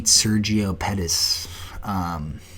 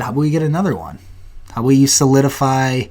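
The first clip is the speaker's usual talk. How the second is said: ordinary talk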